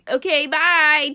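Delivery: high, loud, slow goodbye; cheery sign-off. Real, not acted. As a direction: sing out a big slow bye. Signing off the podcast.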